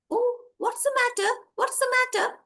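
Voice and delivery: high little butterfly voice, asking with concern. Acted. Butterfly answers the lost boy's cry, high concerned voice. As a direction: pitch it high as a tiny helper.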